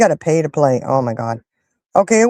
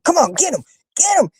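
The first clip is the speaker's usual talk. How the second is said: yelling at TV; 10 semitones higher than usual. Retold or imitated. Fan retells cheering on a boxer, mock yelling at TV. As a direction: egg on a fighter in a raised, urgent pitch. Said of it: "I'm yelling at Mike".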